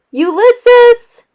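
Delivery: loud, high call into the dark; shouting. A narrator's reading. Tootie calling for the lost squirrel in the dark, shouting loud. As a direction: yell the name out into the night. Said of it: "shouted Tootie".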